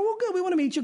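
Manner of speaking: high, sing-song fake cheer; mock them with sarcastic enthusiasm; sarcastic mock welcome. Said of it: "I think they were excited".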